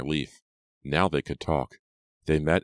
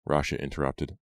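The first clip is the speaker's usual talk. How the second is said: usual speaking voice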